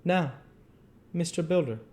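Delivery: ordinary delivery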